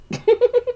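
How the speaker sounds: short high-pitched giggle; a quick squeaky laugh. Real, not acted. Giggling while eating.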